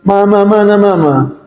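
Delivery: put-on drawn-out child's whine for mama; retold child's crying. Child looking for the mother, mock crying. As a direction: imitate a child wailing slowly for mother. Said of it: "tiba-tiba dia nangis".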